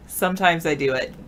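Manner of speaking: normal talking voice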